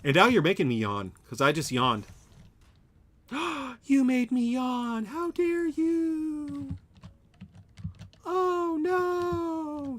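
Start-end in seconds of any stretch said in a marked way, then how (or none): 3.3-3.8 sharp, high theatrical gasp; mock gasp. Mock outrage at a contagious yawn. 3.8-6.8 high, very slow hammed-up indignation; mock outrage. Mock outrage at a contagious yawn. 8.2-10.0 very high hammed-up cry of dismay; mock dismay. Mock outrage continues.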